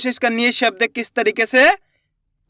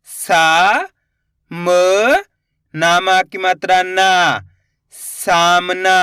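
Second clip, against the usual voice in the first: slow syllable drill; 3 semitones lower, much slower than usual. Shown as a demonstration. Teacher sounding out letters for children, slow and clear.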